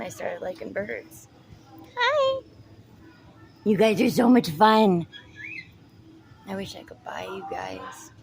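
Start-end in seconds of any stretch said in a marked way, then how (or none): 2.0-5.1 pet talk. Real, not acted. Cooing at pet birds. 6.5-8.1 low voiceless whisper; hushed aside to the camera. Real, not acted. Aside while filming birds.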